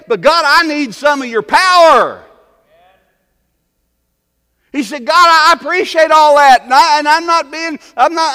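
high, loud, pleading sermon cry; fiery preacher pleading for God's power; full-force preaching. Acted. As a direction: preach it at the top of your voice.

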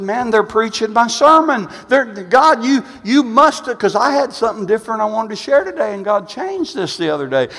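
high, excited retelling, building to a loud peak; amazed preaching. Retold or imitated. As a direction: relive marvelling at God before the congregation. Retells his amazement while preaching.

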